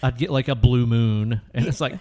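usual speaking voice

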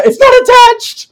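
high, loud, drawn-out disbelief; squealed punchline, voice shooting up; incredulous exclamation. Retold or imitated. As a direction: blurt it out in mock astonishment. Girl exclaiming over detached hand.

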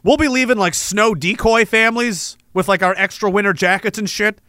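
ordinary talk

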